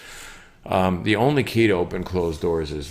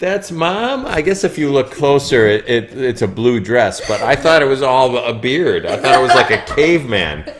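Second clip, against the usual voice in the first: laughing; 8 semitones higher, 10 dB louder than usual. Real, not acted. Laughs at card drawing.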